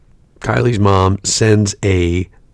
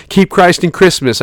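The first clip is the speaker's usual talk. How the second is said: mock pious; 8 semitones higher than usual. Imagined sarcastic reply text.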